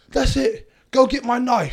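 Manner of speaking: high, loud mock street-fight shout; hammed-up threatening holler; imitated yell. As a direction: yell it like a hothead in a brawl. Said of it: "one guy's yelling out".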